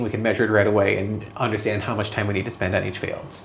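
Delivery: ordinary delivery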